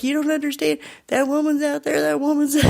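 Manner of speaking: high imitation of a frightened man; mock-frantic plea to be believed; panicked pleading. Scared man begs bouncer, acted out.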